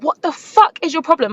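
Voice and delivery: high-pitched mock scream without much volume; retold scream. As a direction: re-enact screaming at him in outrage. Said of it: "So then I start screaming".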